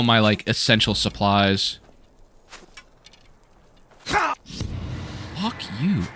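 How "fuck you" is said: high, furious curse; angry yell. Real, not acted. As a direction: spit it at the enemy. Cursing an enemy.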